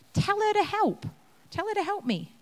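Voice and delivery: a put-upon host demanding help; huffy Martha. Acted. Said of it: "get a little bit huffy".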